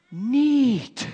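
put-on high, drawn-out childlike "Neat"; childlike wonder. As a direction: say "Neat" like an awed little kid. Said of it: "He goes".

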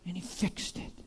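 breathless hushed whisper; awed whisper. Acted. Malchus telling of the healing in an awed whisper. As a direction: whisper it in wonder.

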